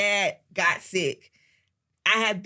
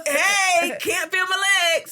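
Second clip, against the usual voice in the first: higher, louder hammed-up dad; mock dad complaining; 10 semitones higher, 6 dB louder, slower than usual. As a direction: play a dramatic dad fishing for pity. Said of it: "he could be like".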